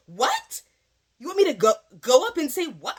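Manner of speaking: high, loud incredulous "what?"; sudden disbelieving outburst; shocked disbelief. Retold or imitated. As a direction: react like you can't believe what you heard. Shocked reaction to co-teacher's request.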